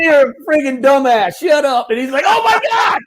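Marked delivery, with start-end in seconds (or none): 0.0-1.9 high, loud teasing rebuke; hammed-up exasperated insult; mock scolding. Mock insult after prank is revealed. 2.3-3.0 very high shrieked imitation; hammed-up astonished cry; shocked realization. Friend's shock at recognizing him.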